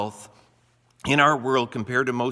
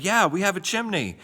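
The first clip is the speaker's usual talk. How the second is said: higher-pitched, excited mock reply; retold excitement; 8 semitones higher than usual. Speaker retells answering a sponsored girl's chimney question, excitedly. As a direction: act out your own eager yes, pitch jumping up. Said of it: "I kinda got excited".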